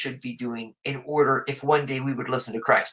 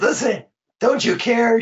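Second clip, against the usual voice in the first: angry complaint; 8 semitones higher, 5 dB louder than usual. Acted. Martha's complaint, acted out.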